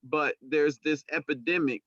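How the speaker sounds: normal talking voice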